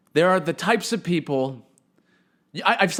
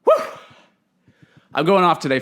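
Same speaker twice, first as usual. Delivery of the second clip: ordinary delivery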